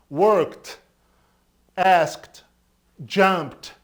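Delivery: loud, clear "Worked", "asked", "jumped" ending in t; "Worked, asked, jumped" each as a single syllable ending in t. Shown as a demonstration. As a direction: punch out each word with a crisp t ending. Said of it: "vai ter som de T".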